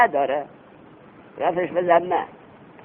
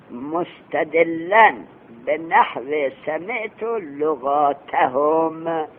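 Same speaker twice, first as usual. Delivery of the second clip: reciting the Arabic text very slowly, word by word; slow text recitation; much slower than usual. Shown as a demonstration. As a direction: spell the quoted line out slowly for the students. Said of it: "عبارت گوش بدید".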